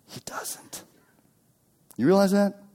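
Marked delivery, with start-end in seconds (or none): 0.1-0.8 breathy stage whisper. Acted. Preacher whispering to the congregation, hushed.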